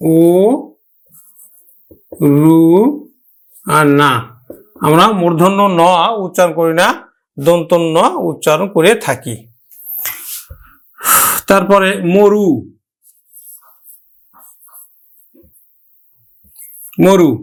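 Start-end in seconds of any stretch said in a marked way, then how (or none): 0.1-4.1 ও, রু, না in separate pieces with long pauses; sound by sound. Shown as a demonstration. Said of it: "আমরা উচ্চারণ করব কিন্তু". 12.1-17.3 মরু as "mo-ru", carefully; closed first vowel. Shown as a demonstration. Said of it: "আদ্য অয়ের সংবৃত উচ্চারণ".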